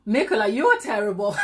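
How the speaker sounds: laughing through a teasing scold; real laughter at a cheeky comment. Real, not acted. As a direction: giggle the words out.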